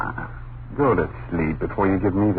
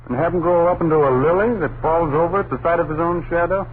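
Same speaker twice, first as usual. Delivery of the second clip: louder, higher mocking scoff; 7 semitones higher, 7 dB louder than usual. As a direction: scoff at the idea of coddling him. Husband scoffs at coddling.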